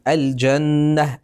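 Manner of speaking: slow, with the lam of "الجنة" sounded clearly; clear lam in al-janna. Shown as a demonstration. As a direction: pronounce the lam of "الجنة" distinctly and slowly. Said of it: "تلاحظون أن اللام ظاهرة". (لام "الجنة" ظاهرة ببطء)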